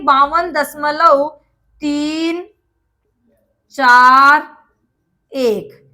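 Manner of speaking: "बावन दशमलव" then "तीन", "चार", "एक" each set apart by long pauses; slow dictation with a pause after every digit. Shown as a demonstration. Teacher dictating to students, slow with a pause after each digit. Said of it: "एक एक करके मतलब इसको हम शब्दों में लिखेंगे".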